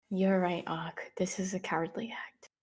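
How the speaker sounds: near-whisper, very soft; barely voiced, in-character murmur; hushed agreement. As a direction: whisper it gravely to a companion. Quiet in-character agreement over the corpse.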